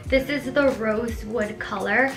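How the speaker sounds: normal talking voice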